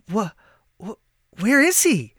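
halting stammer rising high. A narrator's reading. Mary at the empty burial bed, stammering high. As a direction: stumble over the words in shock. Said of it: "Mary finally stammered".

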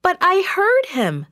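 put-on very high-pitched, insistent girl; high voice of earnest objection; protesting servant girl. A narrator's reading. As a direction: protest high, pleading to be believed. Rhoda protests to doubting believers.

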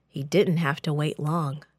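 ordinary delivery, nothing marked

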